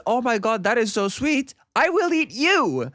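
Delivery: gush, then drop the news sweetly; gushing mock delight. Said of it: "Pina says".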